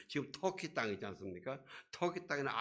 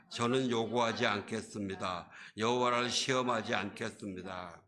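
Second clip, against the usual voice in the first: scripture read aloud; 4 dB louder than usual. Real, not acted. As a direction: read the verse out evenly. Reads the verse aloud.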